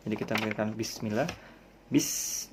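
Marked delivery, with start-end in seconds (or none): 1.9-2.5 Bis clipped short and hissed; rushed start. Shown as a demonstration. Teacher showing the rushed way. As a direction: cut Bis off quickly. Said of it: "jangan buru-buru".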